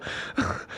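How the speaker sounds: soft wheezy laugh; hushed chuckling. Real, not acted. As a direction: laugh quietly at your own joke. Laughs at own joke.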